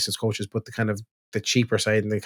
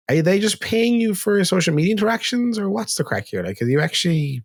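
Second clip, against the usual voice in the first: incredulous; 8 semitones higher than usual. Retold or imitated. Incredulous retort to a sales pitch.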